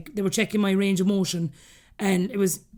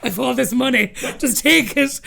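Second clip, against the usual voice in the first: mock Julia Roberts; 4 semitones higher, 4 dB louder than usual. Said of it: "I felt like you and Julia Roberts going".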